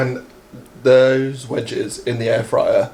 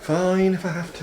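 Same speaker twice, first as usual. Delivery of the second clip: mock reluctant; 5 semitones higher, 5 dB quieter than usual. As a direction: fake grudging sigh, trailing soft. Teasing reluctance about playing FIFA.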